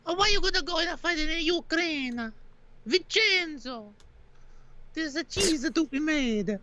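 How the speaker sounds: hammed-up Italian mama and son, high; mock Italian accent. Son tells mama he's off to fight, mock Italian accent. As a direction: play an over-the-top Italian family.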